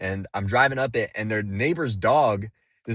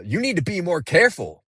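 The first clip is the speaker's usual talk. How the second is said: louder, higher, irritated scolding; agitated warning; 7 semitones higher, 5 dB louder than usual. Retold or imitated. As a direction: snap a warning out the car window. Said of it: "like, agitated tone".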